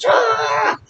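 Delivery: sudden very high wordless mock scream; mob yell. Rioters screaming as they storm Versailles, wild yell. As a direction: let out one wild rioter's cry. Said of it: "shouting them at the top of their lungs".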